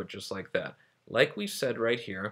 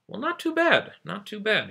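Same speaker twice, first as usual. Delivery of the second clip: high mock approval; 11 semitones higher, 3 dB louder than usual. Real, not acted. Teacher judging revised sentence.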